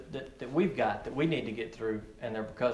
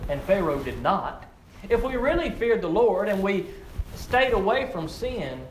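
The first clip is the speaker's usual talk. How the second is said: ordinary delivery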